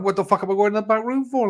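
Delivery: high-pitched indignant outburst; indignant retort. Retold or imitated. To the chemist man, a high incredulous retort. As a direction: throw the question back, incredulous and offended. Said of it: "I'm going".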